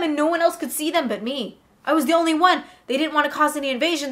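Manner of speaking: ordinary talk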